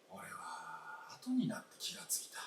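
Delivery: breathy whispered confession; hushed regretful whisper, barely voiced. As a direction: whisper it as if to yourself. Old man's private regret.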